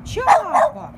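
calling the dog's name up high. Real, not acted. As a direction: sing the name out high to coax the dog. Calling the dog sweetly.

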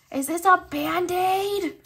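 high, very loud, drawn-out squeal; excited squeal. Real, not acted. Collector finds a surprise freebie, excited squeal. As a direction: squeal the question slowly in delighted disbelief.

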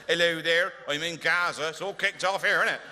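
West Country war correspondent, casual and chirpy; a light West Country lilt reporting from a war zone; West Country reporter. Retold or imitated. Spoofing a war correspondent with a light West Country accent. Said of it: "war correspondents with a West Country accent".

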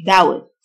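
doubt said "dowt", without the B; silent b. Shown as a demonstration. Teacher showing learners a silent letter, no b sound in doubt. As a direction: say doubt, dropping the b. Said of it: "ال B silent".

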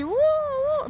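high, loud vuvuzela blast, uh uh; vuvuzela noise. Retold or imitated. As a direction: honk like a vuvuzela to wake them. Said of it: "con mi bubucela así".

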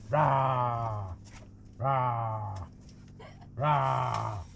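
low playful monster roar; beast roar. Pretend. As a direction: growl it like a beast for the child. Reading beast book to child.

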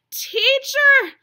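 high loud joyful cry of recognition; gasped cry. Acted. Mary recognizing Jesus at the tomb: high, loud joyful cry. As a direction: call out his name in sudden joy. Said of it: "she gasped".

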